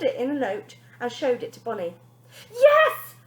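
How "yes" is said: high, loud shrieked yes; screamed yes. Acted. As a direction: scream it at father. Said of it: "the girl screamed".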